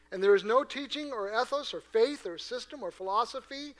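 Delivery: loud, emphatic pulpit voice; preaching with fervour. Acted. Pastor proclaiming Jesus' example, fervent preaching. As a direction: press each claim on the hearers.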